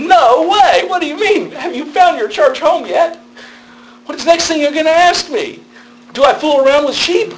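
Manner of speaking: incredulous mock outrage, voice jumped way up; mock disbelief. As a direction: play the scandalised foreigner, laughing at the end. Retelling shocked reaction to question.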